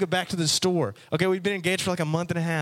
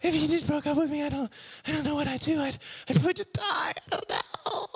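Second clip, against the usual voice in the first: high, breathy mock girlfriend voice; mocking a dramatic girl who can't live without him; 10 semitones higher, 4 dB quieter than usual. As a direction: play the melodramatic girlfriend, pitched way up. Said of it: "the girls are so dramatic".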